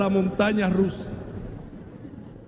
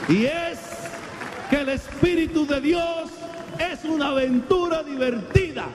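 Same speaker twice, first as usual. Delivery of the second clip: high, raised exhortation to the congregation; fiery preaching; 6 semitones higher than usual. Acted. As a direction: preach it out, calling them to climb aboard.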